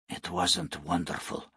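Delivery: low, hushed, sober reply; gently damp the boy's excitement, very softly; soft-spoken. A narrator's reading. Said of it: "said Nu softly".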